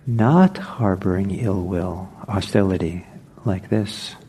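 ordinary delivery

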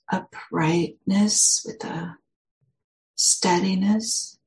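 slow, measured meditation guidance with long pauses; guided meditation. Real, not acted. Meditation teacher settling listeners into their posture, guided meditation. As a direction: guide listeners gently into the body, unhurried.

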